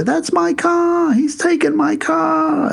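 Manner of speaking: retelling a robbed posh man crying out the window; high slow mock shout. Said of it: "He's shouting out the window".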